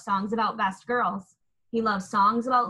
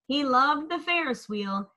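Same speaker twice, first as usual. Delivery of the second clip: usual speaking voice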